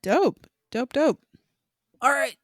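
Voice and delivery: ordinary delivery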